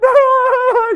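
very high, loud comic bawling; hammed-up high sobbing wail; mock crying wail. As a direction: imitate an overcome mother wailing. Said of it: "Why are you crying, Mommy?".

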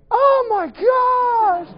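high, slow, stretched-out gasp of amazement; drawn-out astonished oh my gosh; shocked squeal. Retold or imitated. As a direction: squeal it like you can't believe your eyes. Retold shock at her new look.

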